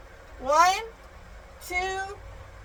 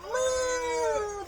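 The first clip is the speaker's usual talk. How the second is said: long, high drawn-out moo; cow moo; 4 semitones higher, 6 dB quieter than usual. Pretend. Mother showing child how the cow goes, long moo. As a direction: imitate a cow with a sustained moo. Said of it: "The cow goes moo".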